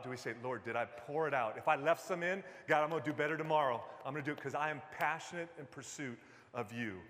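usual speaking voice

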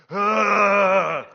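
loud, drawn-out strained groan; grunt of effort. Acted. Preacher mimics himself getting into the car, loud strained grunt. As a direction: heave out a big grunt. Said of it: "I catch myself getting into the car".

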